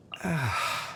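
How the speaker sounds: long, soft tired sigh; a weary exhale through the nose; heavy sigh. Acted. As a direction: let the breath drop out slowly. Weary pause in voice line.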